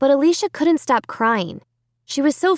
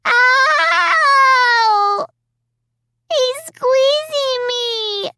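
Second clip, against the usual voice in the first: put-on child's pained cry; 4 dB louder, much slower than usual. A narrator's reading. Child in pain crying.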